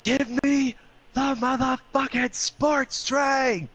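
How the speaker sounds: high, drawn-out imitation of a friend's scream; full-on mimicked screech for a sports drink. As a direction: scream the demand, stretching every word. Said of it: "And he screams".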